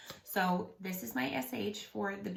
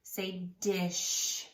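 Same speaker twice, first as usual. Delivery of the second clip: ordinary delivery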